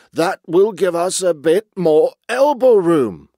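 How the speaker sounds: big, loud, pompous character voice; grunting old chief. A narrator's reading. Old walrus chief pleased at a larger beach, loud grunting voice. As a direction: boom it out with self-satisfaction. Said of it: "The old man grunted".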